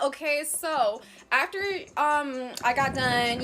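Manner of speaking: ordinary delivery, nothing marked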